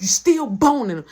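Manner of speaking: loud retold accusation; accusing. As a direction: say it loud, straight back at her. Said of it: "Say it again".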